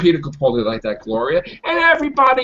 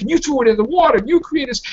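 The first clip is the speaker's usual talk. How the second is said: mock Doctor scolding; 8 semitones higher, faster than usual. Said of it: "he's like".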